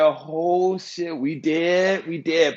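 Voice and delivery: high, drawn-out mock dread; retold alarm. Telling himself they are dead on seeing the gun, retold alarm.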